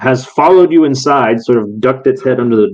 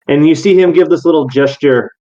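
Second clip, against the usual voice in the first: usual speaking voice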